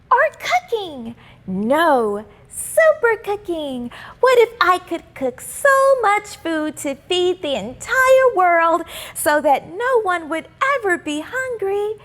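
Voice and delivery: put-on high, bright little-girl voice; hopeful schoolgirl wishing aloud; young girl Simone. A narrator's reading. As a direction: read it as a dreamy young girl. Simone imagines cooking.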